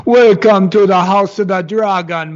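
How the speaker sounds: loud, high mock Jamaican voice, hamming it up; hammed-up Jamaican accent with a laugh behind it. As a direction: parody of the actress's Jamaican accent. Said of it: "Jamaican adjacent accent".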